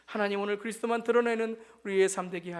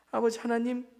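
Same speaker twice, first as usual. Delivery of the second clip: ordinary talk, nothing marked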